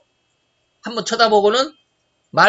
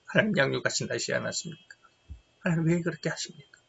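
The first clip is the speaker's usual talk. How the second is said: much softer, pressing plea to God; hushed pleading prayer; 4 semitones lower, 9 dB quieter than usual. Retold or imitated. Father with cancer pleading with God for his children, hushed. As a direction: pray it low and urgent, almost to yourself. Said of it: "늘 기도했습니다".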